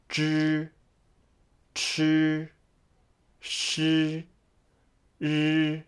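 Zhi Chi Shi Ri drawn out on a level tone; read-after-me pronunciation. Shown as a demonstration. Teacher has learners read after her, drawn-out demo. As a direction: model each Mandarin syllable, pausing for repeats. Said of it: "you may read after me".

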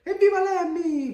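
high, loud storybook hurrah; cheering shout. A narrator's reading. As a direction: whoop it like a whole crowd cheering. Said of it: "gridano tutti". (evviva gridato, acuto ed esultante)

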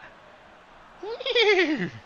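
high squealing mock neigh; whinny like a horse for a laugh. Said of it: "That was my horse impression".

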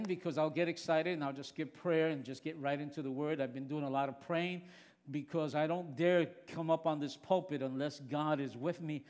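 ordinary talk

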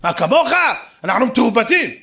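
high, loud mock offence; indignant retort. Father boasts about watching television, loud mock indignation. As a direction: proudly shout it back.

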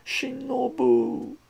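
high, loud, drawn-out name; wailing call. A narrator's reading. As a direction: wail after the departing girlfriend. Hero calls after departing girlfriend.

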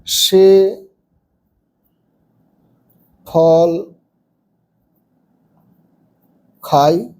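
each word set apart by long pauses; slow dictation. Shown as a demonstration. Teacher dictating sentence three to pupils, slow word by word. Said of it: "তিন নম্বরে".

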